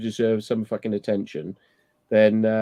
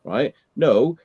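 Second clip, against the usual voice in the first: ordinary delivery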